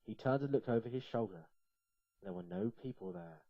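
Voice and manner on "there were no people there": usual speaking voice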